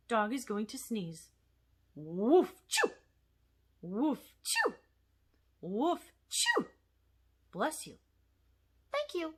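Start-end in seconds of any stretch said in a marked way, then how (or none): 1.9-2.9 barking sneeze. Acted. Dog puppet sneezes. 3.8-4.7 barking sneeze. Acted. Dog puppet sneezes. 5.6-6.7 barking sneeze. Acted. Dog puppet sneezes. 8.9-9.3 puppet voice. Acted. Puppet thanks after sneeze.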